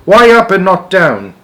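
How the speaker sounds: high, loud desperate shout. A narrator's reading. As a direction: shout it over the wind. Shouting continues.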